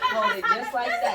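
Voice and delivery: high laughing through words. Real, not acted. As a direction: laugh while giving the instruction. Mock oath, laughing.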